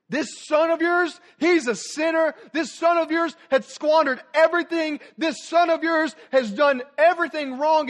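builds from demanding to a high shouting rant; the resentful son yelling his complaint at the father; angry older brother. Acted. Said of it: "This son just looked at him and said".

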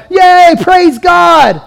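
high, slow mock cheer; hammed-up crowd cheering God; a slow, high, over-the-top yay; cheering praise. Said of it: "they're praising God".

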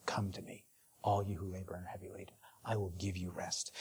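a tender, breathy hush, almost unvoiced; hushed whisper. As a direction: whisper the words of Jesus softly. Quoting Jesus softly.

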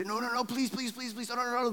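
high, soft, frantic mock pleading; begging for mercy. Demons on the ground begging Jesus for mercy, high and pleading. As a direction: plead in a small, high voice, words tumbling in repeats. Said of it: "they're begging for mercy".

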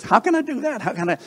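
high-pitched baffled protest; acted-out bewildered questioner, voice shooting up; Nicodemus. Acts out baffled Nicodemus questioning Jesus.

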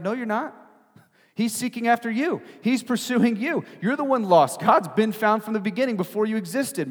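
high, insistent sermon voice; impassioned preaching. Acted. Preacher telling seekers God pursues them, high and insistent. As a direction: drive each point home to the congregation.

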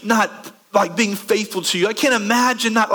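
high, eager over-promising; mock gushing zeal. Imitated believer vowing daily faithfulness, excitedly gushing.

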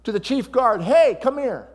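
high, loud astonished king; king calling out. Acted. King calling the chief guard, high and astonished. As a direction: call out like a startled king. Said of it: "he looks into the furnace and he says".

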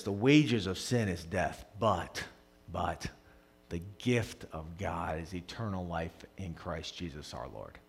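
soft, low, hushed recitation; solemn Scripture quote. Real, not acted. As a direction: let the verse fade into quiet. Quiet, solemn Scripture quotation.

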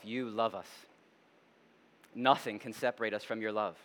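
low, soft pastoral prayer; quiet, reverent address to God. Real, not acted. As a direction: pray softly, pausing between phrases. Leads closing prayer.

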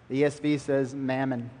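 normal talking voice

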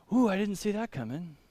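understated, put-on "ooh" of surprise; mock surprise. Pastor voicing an author caught by surprise, mock surprise. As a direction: pretend to be caught off guard, softly.

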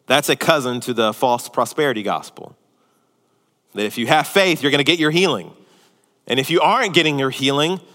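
ordinary talk, nothing marked

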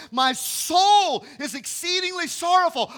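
high, loud and slow lament; loud, slow, anguished high cry; anguished proclamation. Retold or imitated. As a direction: cry out Jesus's grief from the pulpit. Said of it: "And he said to them".

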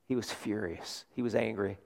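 very soft, low aside; hushed answer. Real, not acted. Preacher answering his own question to the congregation, hushed.

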